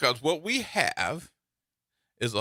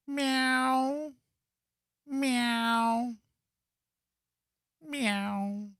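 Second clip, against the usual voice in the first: a string of higher cat meows fading out; mock meows; 6 semitones higher, much slower than usual. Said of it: "No, you're not".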